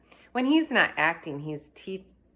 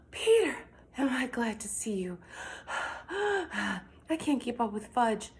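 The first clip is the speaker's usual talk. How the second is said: out of breath; 7 semitones higher than usual. Acted. Mother out of breath.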